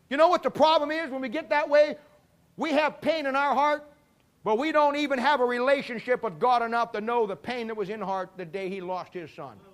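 normal talking voice

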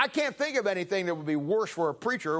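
ordinary talk

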